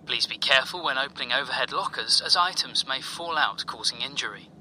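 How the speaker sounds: calm, formal cabin announcement; airport announcement. Acted. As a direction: read it like the PA on landing.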